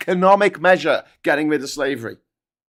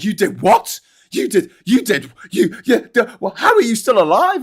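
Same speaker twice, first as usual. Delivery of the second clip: shocked Aussie disbelief, pitched up and louder; incredulous; 4 semitones higher, 6 dB louder than usual. Retold or imitated. Australians hearing about Papua New Guinea, louder disbelief. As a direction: gasp it out like they can't believe you survived. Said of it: "And they're like".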